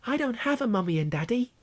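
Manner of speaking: put-on high, small child voice; a boy's sad reply; sad little Harry. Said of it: "Harry replied sadly".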